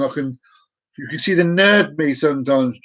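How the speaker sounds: usual speaking voice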